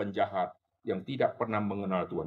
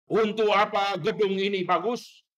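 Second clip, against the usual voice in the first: raised, higher rebuking shout; old pastor shouting; 7 semitones higher, 5 dB louder than usual. Retold or imitated. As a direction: shout the question at the church. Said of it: "dia terus berteriak". (teriakan teguran pendeta tua)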